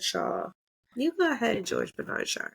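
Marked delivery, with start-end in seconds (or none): 1.7-2.5 hushed name; whispered. Real, not acted. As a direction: breathe the name reverently. Mock reverent address to author.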